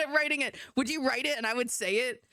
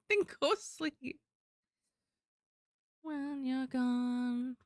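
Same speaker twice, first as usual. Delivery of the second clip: soft slow; 3 semitones higher, 5 dB quieter, much slower than usual. Acted. Soft slowed whisper-like voice.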